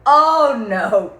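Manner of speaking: mock dismay. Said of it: "I was like".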